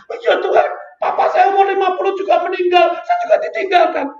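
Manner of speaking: loud, high protest to God; retold protest. Priest arguing with God about his own father, loud and high. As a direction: cry it out to heaven, pitch up. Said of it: "Wah, iya, saya ingat".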